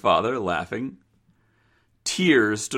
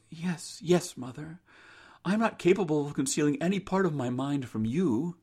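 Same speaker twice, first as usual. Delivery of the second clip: soft, higher daughter's voice; yielding daughter; 6 semitones higher, 6 dB quieter than usual. Acted. Rose confides in her mother, soft and high. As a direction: give in softly and earnestly.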